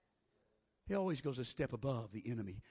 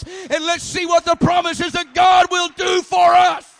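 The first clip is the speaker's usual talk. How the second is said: higher and louder, building to a shouted climax; full force preaching; 8 semitones higher, 20 dB louder than usual. Acted. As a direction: preach it up until you're shouting the promises.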